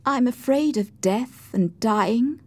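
high, slow and tearful; a touch of frightened tears. A narrator's reading. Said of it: "she said to me in a tearful voice".

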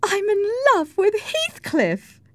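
slow, very high-pitched confession; impassioned declaration. Acted. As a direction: declare your love to the whole table. Isabella declares her love.

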